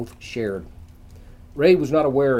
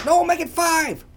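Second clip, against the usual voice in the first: calling across room; 10 semitones higher than usual. A narrator's reading. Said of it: "Ray yelled across the room".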